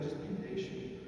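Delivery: very soft, patient answer; gentle reassurance. Acted. Jesus answers an impatient believer, soft and calming. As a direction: quietly soothe the impatience.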